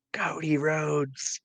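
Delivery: slow, drawn-out whisper; whispered aside. Pretend. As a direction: whisper the name slowly, as an aside. Name dropped as a whispered aside.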